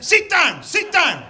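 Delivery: playful mock fury at a rowdy punter; mock angry shout. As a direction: shout it up high and loud, hammed-up. Shouting at a heckler in mock anger.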